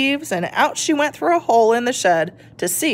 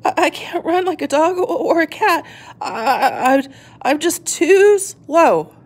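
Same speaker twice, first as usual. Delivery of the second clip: shivering, sad; 7 semitones higher than usual. Acted. Shelley shivers in the snow, halting and sad. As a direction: stammer it out cold and defeated. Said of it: "shivering with cold".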